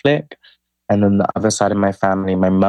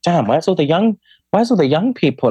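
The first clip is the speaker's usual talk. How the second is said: retold surprise; 8 semitones higher than usual. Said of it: "And I was like".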